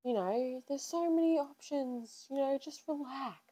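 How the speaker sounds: calm her down like her mum did; reassuring mum. Retold or imitated. Mum calming crying daughter on phone.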